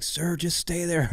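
slow, high coaxing voice; bystander's plea. Retold or imitated. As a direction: beg him to stay down. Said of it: "they're like".

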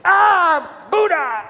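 high, loud falling yelp like cursing a stubbed toe; mock pained outburst, pitch dropping off; acted cry of pain. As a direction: cry out as if you've smacked your leg. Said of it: "smack their leg and go".

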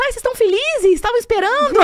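high, bright-eyed questions like the nurse; cheery nurse. Retold or imitated. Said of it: "Aí a moça".

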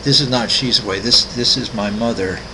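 normal talking voice

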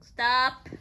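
loud sharp warning; sharp loud stop. Real, not acted. Owner stopping her parrot, loud sharp warning. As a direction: bark it out to stop the bird. Stopping the bird mid-act.

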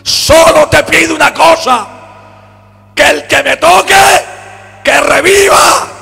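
loud, high, impassioned sermon delivery; fervent Pentecostal preacher in full cry; full-force preaching. Acted. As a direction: preach it out, voice climbing. Preaching with rising fervour.

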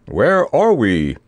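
slow, stretched-out knightly inquiry; knight's booming question. A narrator's reading. Sir Hokus, just landed on a stone lion, asks grandly. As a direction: ask it slowly and grandly, as a knight. Dazed knight after rough landing.

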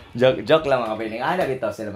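ordinary talk, nothing marked

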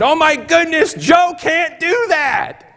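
very high, loud, slow astonished shout; brother-in-law losing it after the healing; came unglued. Retold or imitated. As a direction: shout it in total disbelief. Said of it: "Robbie came unglued".

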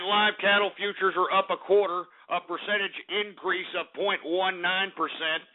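usual speaking voice

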